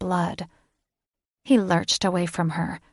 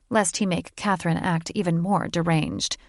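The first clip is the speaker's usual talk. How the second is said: ordinary delivery, nothing marked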